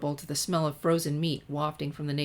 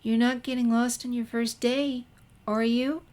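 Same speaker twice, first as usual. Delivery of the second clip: put-on higher, timid girl's voice; Fluttershy; 6 semitones higher than usual. A narrator's reading. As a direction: ask gently, a little afraid to speak up. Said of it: "added Fluttershy".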